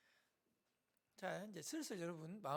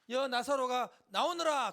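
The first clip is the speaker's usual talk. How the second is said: loud, slow, raised summons; calling out; 6 semitones higher, 12 dB louder, slower than usual. Acted. Jesus summoning Lazarus from the tomb, loud call. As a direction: call someone out with drawn-out command.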